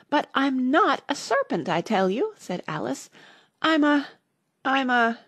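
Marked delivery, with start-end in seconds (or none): 0.1-2.3 high, insistent denial; offended storybook girl; indignant protest. A narrator's reading. Alice protests the accusation. 3.6-5.2 high, halting false starts; searching for an answer; faltering hesitation. A narrator's reading. Alice hesitates over what she is.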